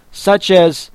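ordinary delivery

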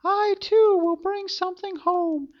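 put-on high girl voice, cheerful; a little girl pleased with herself. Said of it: "And Gretel said".

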